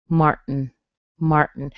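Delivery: "Martin" with a held T then N, no vowel; glottal-style American Martin; nasal release. Shown as a demonstration. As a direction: keep the tongue up from T to N in "Martin". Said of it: "most English speakers will say".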